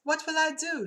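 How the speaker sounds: high-pitched mock despair; distressed wail. Clerk fearing Doctor Nonoki, high acted despair. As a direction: cry it out high, hammed up.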